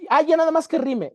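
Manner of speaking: high-pitched mock careless student. Teacher mimics students writing calaveritas, mock careless and high. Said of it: "empiezan a decir cosas".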